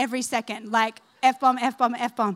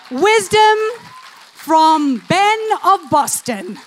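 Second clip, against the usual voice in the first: big, ringing pronouncement, stretched out slowly; loud proclamation; 3 semitones higher, 6 dB louder, slower than usual. Real, not acted. Preacher hails a stranger's saying as wisdom, proclaiming loudly. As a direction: proclaim it loudly to the whole room.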